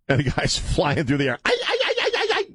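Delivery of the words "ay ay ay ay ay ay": mock high-pitched falling yell; high falsetto screams. Mocking the villain flying through the air, yelled in falsetto. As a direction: squeal way up high like a cartoon victim.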